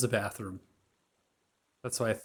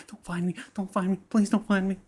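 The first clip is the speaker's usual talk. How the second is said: frightened muttering; 8 semitones higher, 3 dB louder than usual. A narrator's reading. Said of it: "You hear the mutterings".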